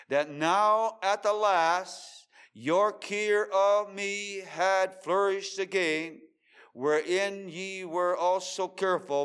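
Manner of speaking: loud, high, slow King James reading; ringing sermon reading; preached scripture. Acted. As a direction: declaim the verse to the hall.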